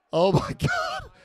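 high, slow shocked "oh my God" that breaks into a laugh; stunned laugh. Real, not acted. Watching his own bloody match, laughs in shock. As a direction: gasp it out in disbelief, laughing.

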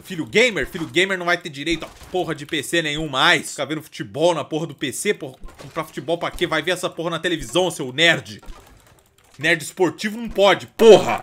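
loud scolding tirade; a furious father shouting at his nerd son; angry dad rant. Pretend. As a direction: tear into the gamer son. Dad yelling at gamer son.

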